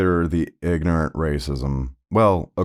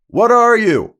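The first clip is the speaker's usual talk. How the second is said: very high, loud shouted question; yelling across; 9 dB louder than usual. Retold or imitated. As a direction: holler it at a stranger across the room. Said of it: "we're both yelling back and forth".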